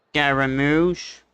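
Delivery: normal talking voice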